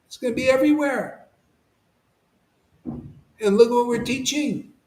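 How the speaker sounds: high whooping mock cheer; mock excitement. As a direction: lampoon the gleeful crowd with a high "Woo". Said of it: "people are just like".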